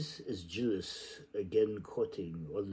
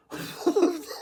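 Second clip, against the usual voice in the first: shrill burst of laughter, very high; a sudden high whooping laugh; high cackle; 8 dB louder than usual. Acted. Wild laugh during reading.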